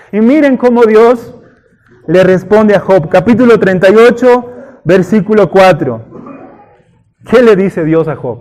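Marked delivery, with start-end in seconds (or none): none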